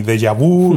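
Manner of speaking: "déjà vu" as de-ya-BU, drawn out slowly; mispronounced déjà vu. Shown as a demonstration. Speaker rejecting wrong versions, déjà vu ending in a slow BU. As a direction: stretch "déjà vu" into a slow deyabú. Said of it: "ni nada de eso".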